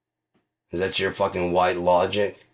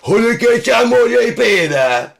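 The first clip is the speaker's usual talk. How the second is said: mock tongues gibberish; 10 dB louder than usual. Comic parodies tongues as kitchen nonsense, high and loud. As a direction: wail gibberish like a possessed preacher. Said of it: "It's not".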